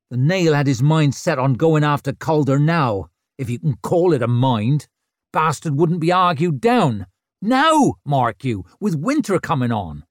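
loud, exasperated complaint; irritated ranting. Acted. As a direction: vent about the Nail. Rikke complaining loudly.

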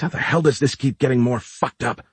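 usual speaking voice

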